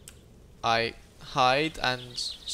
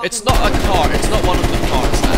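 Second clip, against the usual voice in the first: loud; 5 semitones higher, 11 dB louder than usual. Real, not acted. Frustrated at game.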